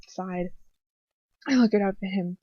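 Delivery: ordinary delivery, nothing marked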